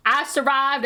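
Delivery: real relief at finishing the pencil strokes; triumphant. Real, not acted. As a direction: crow that you made it through. Finishing the long drawing.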